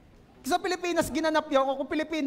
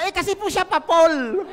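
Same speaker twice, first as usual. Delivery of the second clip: mock excuse; 4 semitones higher, 6 dB louder, slower than usual. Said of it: "Sabi naman ng isa".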